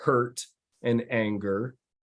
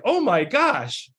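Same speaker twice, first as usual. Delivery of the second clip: much higher, awed voice; amazed client; 7 semitones higher than usual. Retold or imitated. Client telling his coach how the team handled it, high and awed. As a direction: marvel at the team, pitched way up.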